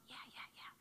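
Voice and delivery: soft, breathy whispered consent; whispered yes. Retold or imitated. The dad whispering permission to another teenager. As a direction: whisper it to keep the moment calm.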